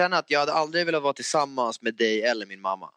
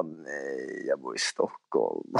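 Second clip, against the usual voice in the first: much lower and quieter aside; retold reply; 11 semitones lower, 9 dB quieter, much slower than usual. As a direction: drop the voice low and soft, as if quoting yourself. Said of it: "Jag bara".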